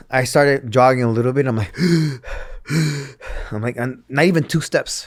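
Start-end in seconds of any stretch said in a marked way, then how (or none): 1.8-3.5 imitated gasp. Out of breath jogging.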